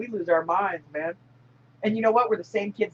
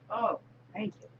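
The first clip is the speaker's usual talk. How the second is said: normal talking voice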